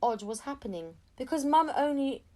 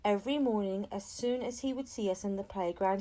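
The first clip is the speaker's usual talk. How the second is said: plain reading voice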